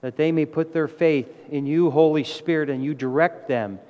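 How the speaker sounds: earnest, measured prayer voice. Acted. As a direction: pray over the congregation. Leads closing prayer.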